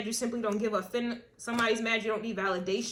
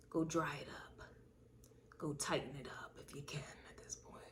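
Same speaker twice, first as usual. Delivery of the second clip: hushed, breathy taunt, much softer; taunting whisper; 6 semitones lower, 13 dB quieter than usual. Acted. Reader whispering to the jealous ex that their time is over. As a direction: whisper the verdict like a secret threat.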